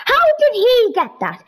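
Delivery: high, loud indignant question; high incredulous cry; 1 outraged surprise. Real, not acted. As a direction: blurt it in disbelief. Villager took the iron.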